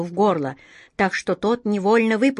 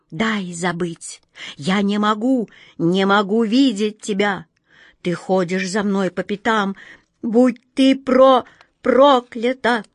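anguished curse; 2 semitones higher, 4 dB louder than usual. Acted.

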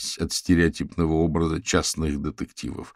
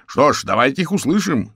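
loud, higher heckle; raised-voice challenge; calling out; 8 semitones higher, 6 dB louder than usual. A narrator's reading. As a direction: shout it across the hall. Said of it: "выкрикнул Фрэнк Маршалл".

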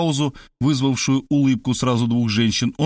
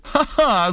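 high, scornful ha-ha; forced mocking laugh; scornful cry; 14 semitones higher than usual. A narrator's reading. Markhalyova showering Maria with contempt, mock laugh. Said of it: "закричала Мархалёва".

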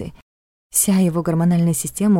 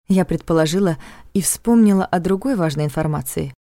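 ordinary delivery

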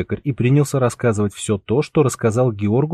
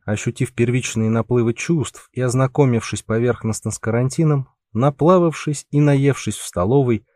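ordinary delivery

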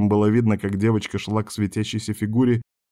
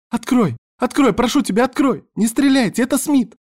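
very high, loud begging cries; panicked girl's screamed plea; frantic pleading; 4 dB louder than usual. A narrator's reading. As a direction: beg desperately through a locked hatch. Girl pleads at locked hatch.

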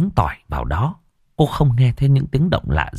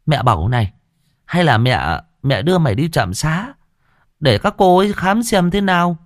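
caring mother-in-law; 6 semitones higher than usual. A narrator's reading. Mother-in-law urging clinic visit.

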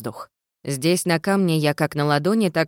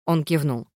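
plain reading voice

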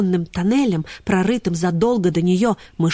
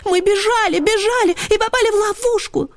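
excited greyhounds; 14 semitones higher than usual. Acted. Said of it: "загалдели борзые".

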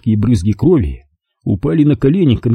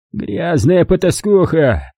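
shouting; 7 semitones higher, slower than usual. A narrator's reading. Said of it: "закричал он".

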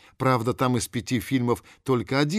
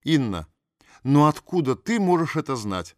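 skeptical surprise; 5 semitones higher, 5 dB louder than usual. A narrator's reading. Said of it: "подняла брови бабушка".

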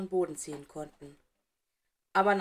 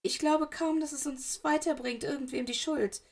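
higher, trembling voice near tears; zittrige Stimme; 7 semitones higher than usual. A narrator's reading. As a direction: hold back tears, voice wobbling. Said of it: "ihre Stimme klang sehr zittrig". (hohe, zittrige Stimme den Tränen nah)

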